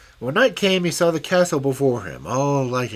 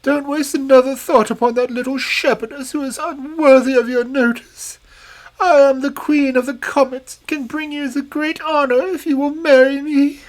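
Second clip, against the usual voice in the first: much higher, louder, strange fairy voice; a vain, scheming old fairy crone; shrill-free high hag of a fairy; wicked old fairy; 8 dB louder than usual. Acted.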